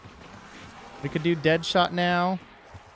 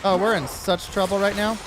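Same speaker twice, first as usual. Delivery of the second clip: panicked shout; 6 semitones higher than usual. Real, not acted. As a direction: yell in alarm at running dry. Out of ammo, panicking.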